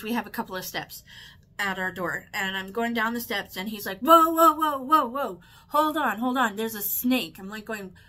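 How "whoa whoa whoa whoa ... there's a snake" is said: loud, raised string of whoas; sudden stop-right-there warning, easing off; retold alarm. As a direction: urgently imitate someone halting you. Retold sudden warning about a snake.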